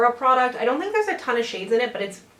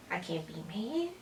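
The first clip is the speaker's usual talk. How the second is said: soft cooing; 4 semitones lower, 13 dB quieter than usual. Real, not acted. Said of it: "now you wanna sit on my lap".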